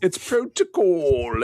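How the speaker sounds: hammed-up British droid accent; funny British accent. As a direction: stretch it out in a comic British accent. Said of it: "in his British accent, in his funny British accent".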